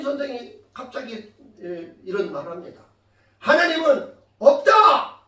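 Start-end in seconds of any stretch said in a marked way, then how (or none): 3.4-5.2 loud, drawn-out higher shout; shouted order. Retold or imitated. Teacher ordering pupils to deny God, shouted order. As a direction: call it out slowly as a command. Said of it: "라고 외치라고 합니다".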